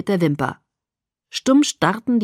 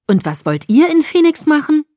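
biting; 9 semitones higher, 7 dB louder than usual. A narrator's reading. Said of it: "fragte er beißend".

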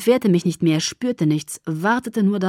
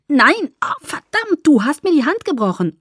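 high, loud cursing in pain; frantic, pain-stricken accusation; pained outcry; 8 semitones higher, 5 dB louder than usual. A narrator's reading. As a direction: blame him through the pain. Acted outcry over a broken hand.

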